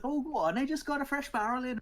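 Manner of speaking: high, loud coaxing halfling barkeep; wheedling West Country innkeeper; cheerful small homely voice. Acted. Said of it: "This is my halfling voice".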